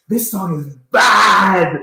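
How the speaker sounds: slow, high, loud Jamaican exclamation; drawn-out Jamaican praise, shouted; Jamaican accent. Retold or imitated. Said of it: "in a very thick Jamaican accent".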